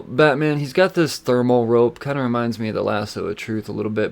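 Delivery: ordinary delivery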